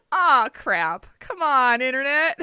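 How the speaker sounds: usual speaking voice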